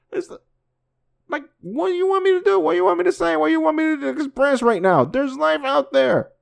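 very high, strained voice with a laugh breaking in; overwhelmed excitement. Real, not acted. Vlogger telling viewers there is life out there, very high and overwhelmed. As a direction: squeal it out, barely containing yourself.

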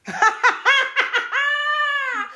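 high squealing laugh; burst of laughter. Real, not acted. Laughing at her friend's version of events.

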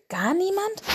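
Gar niemand? rising very high. A narrator's reading. Father bear presses his son, amazed. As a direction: disbelief climbing further. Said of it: "fragte Vater Eisbär erstaunt".

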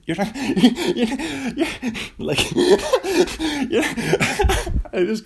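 helpless high laughter breaking up words; cracking up. Real, not acted. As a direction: unable to finish for laughing. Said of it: "I just crack myself up so much".

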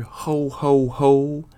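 a touch of Santa's jolly call. A narrator's reading. Narrator evokes Santa's call at night, lightly voiced. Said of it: "if you ever hear".

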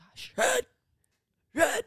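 whispered mock warning; hushed, retold urgency; urgent whisper. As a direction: whisper it like a secret urging to escape. Secretly urging someone to flee.

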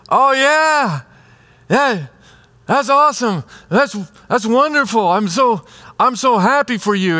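forced high cheerful congratulations behind a mask; masking envy to congratulate a friend, forced cheer; fake happiness. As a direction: hide your envy behind a bright, high voice. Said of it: "you take off the mask and you put on another mask".

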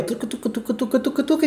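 light rising tucu rhythm; rhythmic pedalling sound. Retold or imitated. (som de pedalar imitado)

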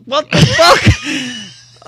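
high, loud, laughing what the fuck; shocked outburst. Retold or imitated. Retelling his brother's text, shocked laughing outburst. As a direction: blurt it out in stunned disbelief. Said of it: "And I was like".

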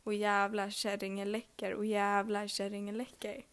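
ordinary talk, nothing marked

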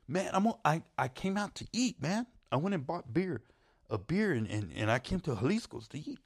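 high, stammering string of excuses; imitated defensive friend. Friend explaining where his money went, high mock-defensive excuses. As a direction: plead your case in a put-on high voice.

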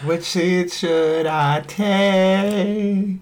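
slow, drawn-out silly voice. Pretend. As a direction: ask it in a dopey voice. Silly put-on voice.

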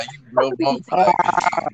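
high, warped alien transmission; garbled like a broken radio link. Real, not acted. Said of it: "Why does [name] sound like an alien".

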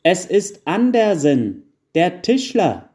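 loud, high, slowed-down announcement; calling into ear. A narrator's reading. Wife shouting into old grandfather's ear, loud and slow. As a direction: spell it out loudly for someone hard of hearing. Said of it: "rief Frau Weihnachtsmann in sein Ohr".

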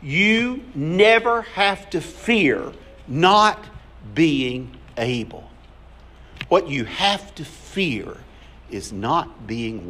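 slow, drawn-out, stress on never; slow emphasis. Real, not acted. Pastor urging the congregation to remember, slow and deliberate. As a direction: let each word land slowly. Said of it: "Let me say this and do not forget it".

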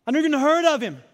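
loudly protest, mildly acted; Peter's denial. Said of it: "hearing his own words echo".

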